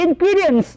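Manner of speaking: "Ingredients" called out high for the class; teacher's high-pitched repeat-after-me "Ingredients"; modelled for repetition. Shown as a demonstration. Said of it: "After me please".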